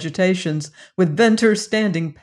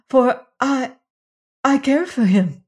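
higher, louder desperate pleading; desperate plea; 6 semitones higher, 5 dB louder than usual. Acted. Jane crying to the rider to save Venters, desperate. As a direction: cry out begging for him. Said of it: "I pray you".

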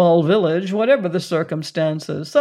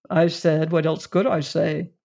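ordinary delivery, nothing marked

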